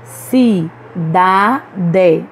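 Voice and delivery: "Cidade" in separate syllables, strong da; stress on penultimate syllable. Shown as a demonstration. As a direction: break "Cidade" into Ci... da... de, leaning on da. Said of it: "pronunciamos com mais força a penúltima". ("Cidade" silabado, com força em da)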